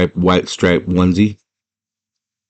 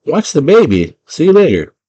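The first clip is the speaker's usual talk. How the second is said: high, louder mock-dad call; raised-voice parental send-off; 9 semitones higher, 5 dB louder than usual. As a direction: imitate a dad calling through a door as he leaves. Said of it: "He's like".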